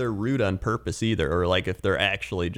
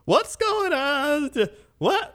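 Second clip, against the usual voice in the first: confused shopper. Retold or imitated. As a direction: play a lost shopper at self-checkout. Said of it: "Some people are like".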